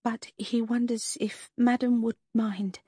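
a lightly voiced shy waitress, slow and hesitant; sheepish waitress. A narrator's reading. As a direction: hesitantly ask the lady to do something awkward. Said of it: "she began sheepishly".

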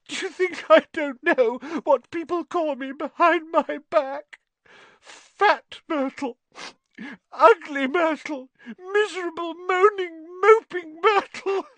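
very high, sobbing self-pity; squealing, hammed-up crying lament; tearful wailing. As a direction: wail each insult through tears, building. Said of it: "tears now flooding down her face".

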